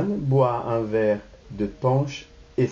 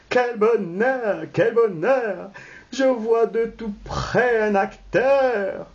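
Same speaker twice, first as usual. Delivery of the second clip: jumping for joy; 8 semitones higher than usual. Acted. Madame Balandard repeats her joy at seeing actors, gleeful. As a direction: squeal it with swooping delight. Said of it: "Sautant de joie".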